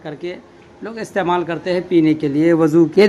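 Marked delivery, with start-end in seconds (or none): none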